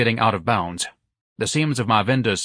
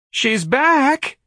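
much higher, louder dismayed cry; heartbroken cry; 5 dB louder than usual. A narrator's reading. Office manager dismayed at the clerk's return. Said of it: "Mister Gonzalez cried heartbrokenly".

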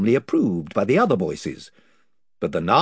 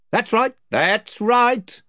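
higher, blustering chief; invisible chief; 12 semitones higher, 4 dB louder, slower than usual. Acted. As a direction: boast of his band's numbers.

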